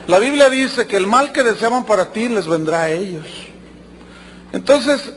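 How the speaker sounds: ordinary talk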